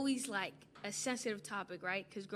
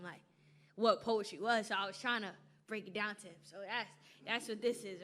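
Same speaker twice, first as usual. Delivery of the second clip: ordinary talk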